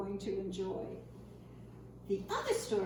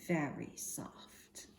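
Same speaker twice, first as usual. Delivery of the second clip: soft, slow whisper; slow hushed whisper; 8 semitones lower, 7 dB quieter, much slower than usual. Acted. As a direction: breathe the words out gently to show softness. Describes porcupine's soft underside.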